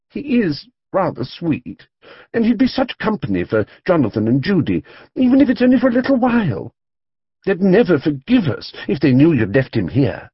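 firm. A narrator's reading. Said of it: "voice had a firm note".

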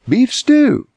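very high, loud, cheery announcement; chirpy mom presenting dinner; cheerful. A narrator's reading. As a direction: sing out the treat cheerfully. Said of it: "his mother said cheerfully".

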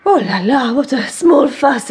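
Monsieur Profond. Retold or imitated. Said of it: "as Monsieur Profond would say".

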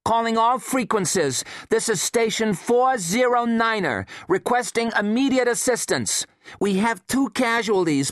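high, tense radio operator voice; urgent radio distress call. Acted. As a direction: plead for help over the radio.

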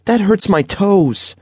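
high, slow, whiny complaint; hurt little boy. A narrator's reading. Boy telling his mother the shoes hurt, slow high whine. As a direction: let the pain drag in a child's voice.

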